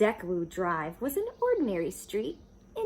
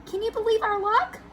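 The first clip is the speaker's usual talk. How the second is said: high, loud, thrilled wife; delighted; 14 semitones higher, 8 dB louder than usual. Acted. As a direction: burst out with joy.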